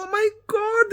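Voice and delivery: very high mock squeal; high-pitched, hammed-up horror; scandalised shock. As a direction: imitate a scandalised shriek without extra volume. Girl scandalised, retold.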